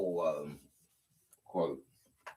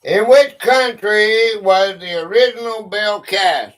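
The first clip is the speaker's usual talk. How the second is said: higher, louder quizmaster voice; quiz question read-out; 5 semitones higher, 8 dB louder than usual. Real, not acted. Host puts a history question to players, loud quiz read-out. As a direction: put the question to all the players.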